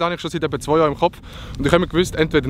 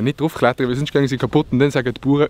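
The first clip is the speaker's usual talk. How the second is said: normal talking voice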